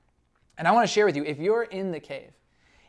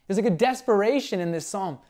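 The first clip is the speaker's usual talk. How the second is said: ordinary talk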